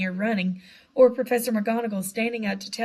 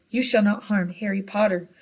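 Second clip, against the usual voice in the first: plain reading voice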